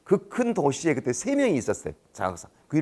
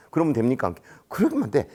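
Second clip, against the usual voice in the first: ordinary delivery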